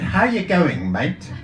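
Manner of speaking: a friendly Aussie bloke's hello; cheery Australian accent. Retold or imitated. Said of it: "he had an Australian accent".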